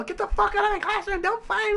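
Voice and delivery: high mock teacher yell. Mocking a yelling teacher, pitched high. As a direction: play the fed-up teacher ordering them out. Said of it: "Or they'll yell".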